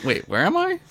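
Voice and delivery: high, bewildered startled wake-up; suddenly jolted awake. Pretend. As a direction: come to like you just snapped awake. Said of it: "[name] forgot his brain meds".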